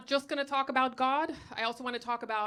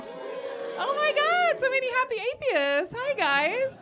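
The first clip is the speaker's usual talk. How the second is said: high, loud squeal of delight; gushing greeting; 14 semitones higher, 5 dB louder, slower than usual. Pretend. Comic greets the atheists who cheered, gushing in mock delight. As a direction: gush at them in a drawn-out high squeal.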